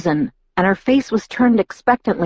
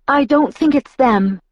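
higher-pitched insistence; Alice, insistent; 7 semitones higher than usual. A narrator's reading. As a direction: push back, pitched up. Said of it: "Alice insisted".

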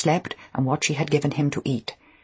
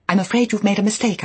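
higher, quick, flustered reply; nervous correction; 6 semitones higher, 4 dB louder, faster than usual. A narrator's reading. Nervous new wife correcting an unknown caller, flustered. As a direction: put the caller right in a raised voice.

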